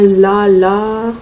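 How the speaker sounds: "la" repeated as a lone first syllable. Shown as a demonstration. Teacher matching lapin to lavabo, repeating the syllable. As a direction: tap out "la, la" to match the word. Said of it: "On peut répéter la première syllabe".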